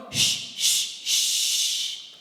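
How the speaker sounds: long forceful hiss of air; strong shh. Shown as a demonstration. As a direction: push the air out hard from the diaphragm. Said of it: "we're gonna go really strong".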